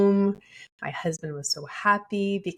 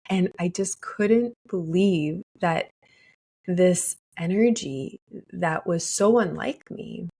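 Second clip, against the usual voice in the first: normal talking voice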